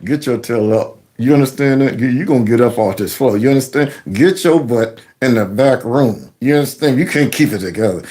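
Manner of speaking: low, stern aside through a smile; covert scolding. Acted. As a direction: scold him under cover of a fake smile. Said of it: "the smile, the fake smile".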